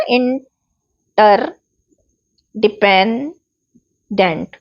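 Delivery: इंटरडेंट very slowly, with long gaps between the pieces; broken into syllables. Shown as a demonstration. Teacher showing how to break down long words, इंटरडेंट one slow syllable at a time. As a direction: split इंटरडेंट into separate parts, pausing after each. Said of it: "छोटे छोटे टुकड़ों में break down कर लीजिए".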